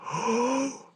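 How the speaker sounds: high-pitched gasping "oh"; gasp, then a high drawn-in realisation; imitated shocked gasp. Friends get the worker's hint, mock shocked gasp. Whole group reacts to the hint.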